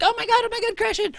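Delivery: put-on high, squealing kid voice; excited kid. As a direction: play an overexcited kindergartener. Kids excited by the game.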